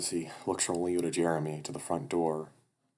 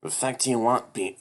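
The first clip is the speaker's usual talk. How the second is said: loud, raised-pitch bully voice; acted angry challenge; 6 semitones higher, 6 dB louder than usual. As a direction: challenge him loudly, in character. Bully confronts the newcomer.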